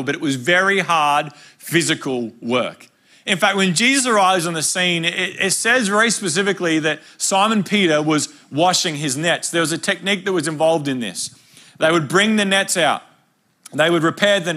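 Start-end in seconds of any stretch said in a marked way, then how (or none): none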